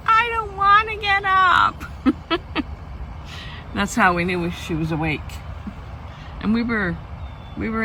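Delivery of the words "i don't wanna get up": high, slow whiny mock scream. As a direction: wail it like a grumpy teen. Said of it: "sometimes she would scream".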